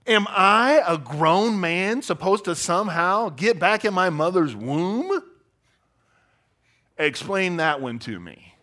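a hint of sneering sarcasm; sarcastic. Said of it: "Insert sarcasm".

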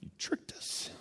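soft breathy whisper; hushed, half-laughing accusation. Retold or imitated. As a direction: whisper it in mock betrayal. Kids accuse mom.

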